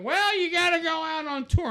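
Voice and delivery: high, loud mock insistence; mocking the industry. Mimicking record people telling John to tour, high and loud. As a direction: lecture him like a pushy label exec. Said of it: "they're like".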